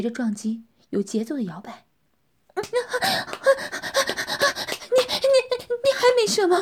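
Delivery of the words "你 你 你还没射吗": high, louder moaning question. Acted. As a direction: gasp the question out. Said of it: "女人呻吟着问". (呻吟着问)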